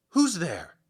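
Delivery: loud, high startled call into the dark; fearful loud question. Acted. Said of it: "he asked fearfully".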